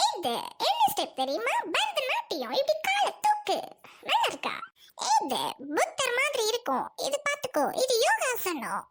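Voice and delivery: high, wildly swooping put-on voice; pompous caricature of a dance instructor; showy dance teacher. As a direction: show off each step in exaggerated sing-song. Dance teacher shows absurd steps.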